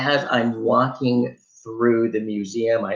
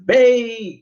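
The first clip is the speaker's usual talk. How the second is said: smug clerk; 11 semitones higher, 6 dB louder than usual. Retold or imitated. Clerk closes the counter smugly.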